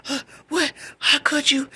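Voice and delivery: high, tearful mock wail; acted crying. Crying to boyfriend on the phone, acted tearful hurt. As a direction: imitate crying in shocked betrayal. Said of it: "I'm crying".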